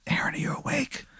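soft, voiceless whisper; hushed midnight whisper; secretive whisper. Acted. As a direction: whisper to check if someone is asleep. Character sneaks in at midnight.

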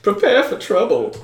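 high, hammed-up villain motto; Team Rocket. Game master jokes about raiders' introductions, hammed-up villain voice. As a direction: announce it as a mock villain. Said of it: "They're Team Rocket".

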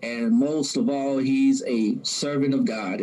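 usual speaking voice

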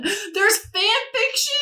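high voice squeezed by real laughter; cracking up. Real, not acted. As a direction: struggle to speak through the giggles. Cracking up at finding fan fiction.

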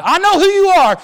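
high, loud challenge; the demon admits knowing Jesus; defiant demonic faith. Acted.

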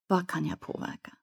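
soft unvoiced whisper; a whispered reminder closing the thought; whispered mantra. Real, not acted. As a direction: breathe the question quietly to yourself.

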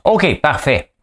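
ordinary delivery

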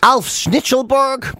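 slowly savouring the name; drawn out. Shown as a demonstration. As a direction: stretch the name out grandly. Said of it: "savouring cat name".

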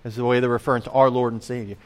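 usual speaking voice

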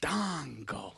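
Fandango drawn out as "Fahhn-dahhn-go"; exaggerated mispronunciation. Shown as a demonstration. Answering which website, Fandango mockingly drawn out as Fahn-dahn-go. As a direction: stretch both "ah" vowels in Fandango.

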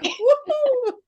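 high-pitched whooping cheer; excited cheer. Retold or imitated. Fan reacting when the Hermione kicks in, thrilled whoop. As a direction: let out a high fangirl whoop. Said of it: "and I'm like".

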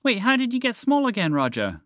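high, astonished mouse voice; high-pitched startled question; gasped surprise. Acted. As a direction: ask as if suddenly realising. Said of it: "Montgomery the Mouse gasped".

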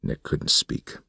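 ordinary delivery

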